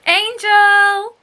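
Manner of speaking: high ringing call across the beach; shout. A narrator's reading. Anna calling her cousin across the sand, loud shout. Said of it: "shouts Anna Hibiscus".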